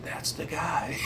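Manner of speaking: voiceless, astonished whisper; hushed aside. Retold or imitated. Recognizing the officer who ticketed him, hushed aside. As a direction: whisper it under your breath.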